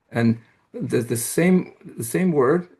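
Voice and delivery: ordinary talk, nothing marked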